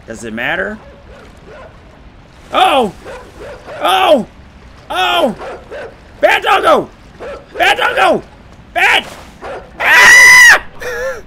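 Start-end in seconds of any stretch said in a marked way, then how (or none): 2.5-9.1 high, loud yells of bad doggo; alarmed scolding. Real, not acted. Gamer yelling at a game dog to back off, high and loud. 9.8-11.2 shrill startled scream, very high and loud; a sudden terrified shriek. Real, not acted. Startled gamer screams as the dog lunges, shrill and loud. Dog attack jump scare.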